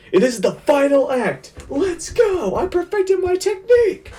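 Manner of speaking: very high, strained hero's shout without real volume; mock battle yell. Fan voicing a poisoned hero rallying for the final act, mock yell. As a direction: yell it like an anime battle cry. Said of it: "he's like, he yells".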